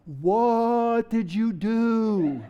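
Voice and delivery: very slow, drawn-out mock dismay; long, drawn-out appalled question; appalled inner thought. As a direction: stretch every word out in silent horror. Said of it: "you thought to yourself".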